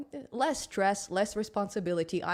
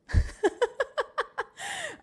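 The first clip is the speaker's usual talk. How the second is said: high giggle; 3 dB louder than usual. Real, not acted. Laughs at the silent intro.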